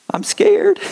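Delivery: very high, squeaky and timid. Retold or imitated. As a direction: squeak it like a frightened kid. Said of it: "said in a squeaky voice".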